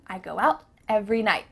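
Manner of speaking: quick, unreleased t in "out", "night"; fast casual model sentence; stopped T. Shown as a demonstration. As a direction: run it briskly, cutting t in "night". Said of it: "it's just stopped".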